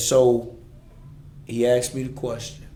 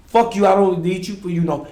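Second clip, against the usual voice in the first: loud, higher cursing at father; reenacted angry shout; 6 semitones higher, 9 dB louder than usual. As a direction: shout it again in role play. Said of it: "I had to keep saying it".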